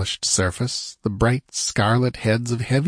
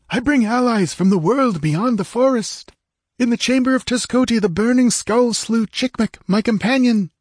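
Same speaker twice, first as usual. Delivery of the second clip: high-pitched, urgent warrior voice; excited tribesman addressing his prince, pitched high; Techotl's excited report; 13 semitones higher, 3 dB louder than usual. Acted. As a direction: declaim the news in a high character voice.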